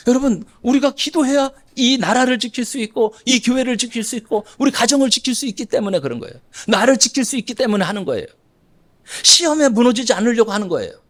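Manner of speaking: fervent preaching. Acted. Preaching at force.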